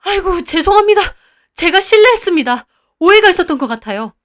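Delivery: bow and scrape; groveling apology. Acted. Owner apologizing to the grandfather, high, loud and hurried.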